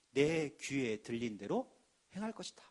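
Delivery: low, slow, grave divine voice; solemn voice of God. Acted. Preacher voicing God to the congregation, low and solemn. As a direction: intone God's words deep and slow. Quoting God's word solemnly.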